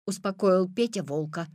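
ordinary delivery, nothing marked

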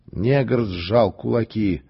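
usual speaking voice

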